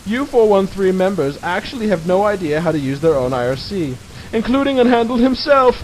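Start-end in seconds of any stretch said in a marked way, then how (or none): 4.3-5.8 laughing. Real, not acted. Mocking laugh at own joke.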